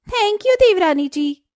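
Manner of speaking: high, slow, drawn-out sarcastic thanks. Acted. Swati thanking Payal sarcastically, high and slow. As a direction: stretch the thank you with sarcasm.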